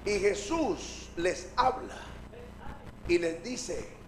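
ordinary talk, nothing marked

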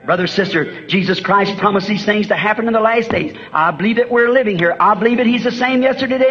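ordinary talk, nothing marked

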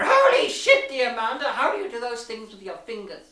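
mock orgasmic exclamation, mostly on the first words; mock ecstatic cry. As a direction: overplay a breathless climax, teasing.

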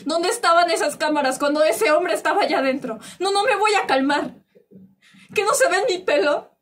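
loud, high-pitched indignation; anguished fury at being disbelieved; outraged protest. Acted. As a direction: demand answers, refusing to calm down. Victim confronts officials, refuses to calm down.

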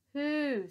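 high, loud trumpeting call; loud mother mammoth hoo; big mammoth hoot. Acted. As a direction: sound off like the herd leader. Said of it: "sounded the largest animal".

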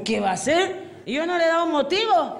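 hammed-up tipsy self-defence; clueless drunk. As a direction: play an innocent, muddled drunk. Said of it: "Y el borracho dice".

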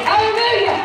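hallelujah cried out high and loud; shouted hallelujah. Real, not acted. Preacher shouting praise.

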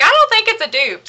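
high, loud mock voice of a friend; quoting a friend. Friend texting that it's not a dupe, high and loud. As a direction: squeak it out like a friend disagreeing. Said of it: "And she's like".

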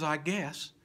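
spooky storyteller's reading, swinging from whisper to loud; theatrical recitation for children; dramatic poem recitation. A narrator's reading. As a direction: tell it like a fireside ghost tale.

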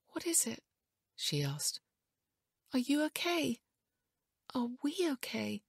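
high, hushed anxious questions; worried. A narrator's reading. Wife asking whether the couple are okay, worried.

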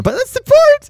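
high, loud squawked insistence; exaggerated high-pitched yell; mock outburst. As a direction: blurt it out at the top of your pitch. Acted escalation of mock supporter.

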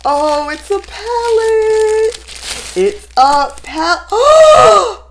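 high, drawn-out words breaking into a gasp; astonished, high and slow; stunned delight. Real, not acted. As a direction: realise it slowly, then gasp loudly. Unwraps a long-wanted gift.

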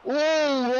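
sudden loud high gasp; surprised shout. Real, not acted. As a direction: shout out at the near miss. Near miss in the game.

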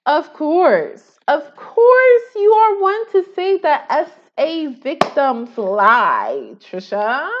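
loud, high, slow accusing cry; mock accusation. Voicing Ethan accusing Trisha, loud and slow. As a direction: call her out with fake outrage.

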